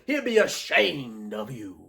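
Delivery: slow, stretched-out ASHAMED; imitating a slow, dramatic put-down; drawn-out delivery. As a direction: linger long on the word "ashamed". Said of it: "I like that delivery".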